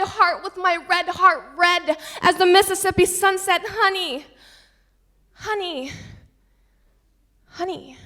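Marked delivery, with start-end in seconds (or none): 3.7-8.0 fading call. Acted. Poem ending, repeated fading call.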